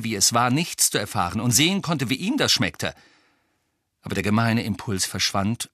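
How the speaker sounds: ordinary delivery, nothing marked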